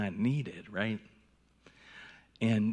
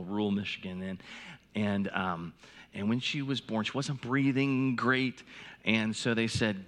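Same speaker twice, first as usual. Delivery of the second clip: normal talking voice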